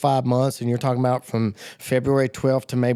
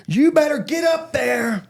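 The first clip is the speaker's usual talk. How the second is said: high, drawn-out shouted order; slow, ringing imitated holler; commanding shout; 13 semitones higher than usual. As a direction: imitate a leader urgently yelling someone forward. Leader ordering him to the altar.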